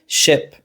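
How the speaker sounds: "ship" with a short, lax i; short vowel. Shown as a demonstration. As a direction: clip the vowel in "ship", as against "sheep". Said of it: "clear vowel contrasts".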